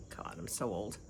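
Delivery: low whisper to herself; whispered aside. Real, not acted. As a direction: mutter it as a private joke. Self-deprecating aside.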